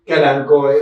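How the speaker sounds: "calanchoe" said as "kalankoe"; hard K in "calanchoe"; Latin-style "calanchoe", K for CH. Shown as a demonstration. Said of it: "todo con K".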